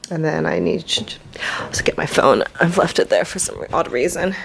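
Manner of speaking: hushed aside to herself; whispering. Real, not acted. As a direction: whisper it under your breath. Muttering to herself off camera.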